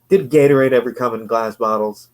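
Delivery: ordinary delivery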